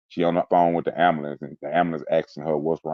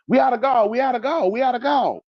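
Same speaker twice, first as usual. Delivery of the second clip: very high, insistent retold alarm; frantic repeated call, pitched way up; urgent calling out; 4 dB louder than usual. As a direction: imitate a medic shouting for supplies. Ambulance man calls out in retelling.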